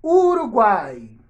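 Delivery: Uruguai drawn out slowly and loudly; slow word demo. Shown as a demonstration. Teacher giving pupils a word to split, slow and loud. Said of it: "Separa Uruguai em sílabas".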